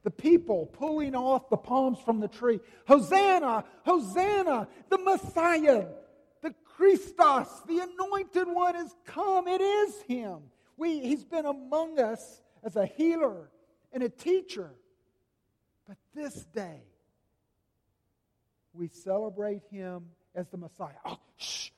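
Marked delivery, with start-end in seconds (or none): 2.9-14.7 high, loud jubilant crowd cries; crowd shouting Hosanna. Acted. Preacher voicing the crowd welcoming Jesus, loud and jubilant. 21.0-21.7 hushing. Acted. Pharisees hushing the crowd.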